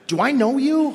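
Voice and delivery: sharp high indignant retort. Retold or imitated. Newcomer snapping back at mean guys, high indignant. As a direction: fire it back, offended.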